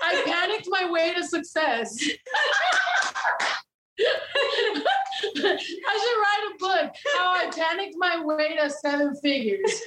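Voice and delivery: laughing at her own joke book title. Real, not acted. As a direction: crack up as you say it.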